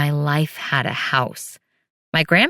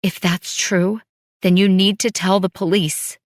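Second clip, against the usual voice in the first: voice shaking; 4 semitones higher, 3 dB louder than usual. A narrator's reading. Widow shaken, demanding he go to police, voice shaking. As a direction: fight to keep your voice steady. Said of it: "My voice shook when I spoke".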